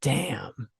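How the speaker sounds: breathless whisper of awe; just breathe the word out, floored; stunned by her son's insight; whispered damn. Retold or imitated. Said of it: "I was like".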